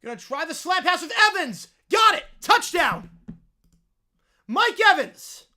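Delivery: loud, high, slow hyped touchdown call; play-by-play call. Acted. Streamer calling the Evans touchdown for viewers, announcer hype.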